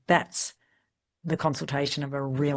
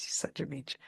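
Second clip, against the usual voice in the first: muttered mock insult; 11 dB quieter than usual. Husband's muttered retort.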